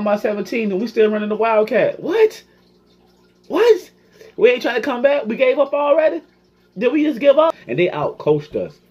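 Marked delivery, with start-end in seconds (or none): 2.0-7.5 shrieking high, loud disbelief at his own team; stunned disbelief. Real, not acted. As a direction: yelp it out in outraged shock.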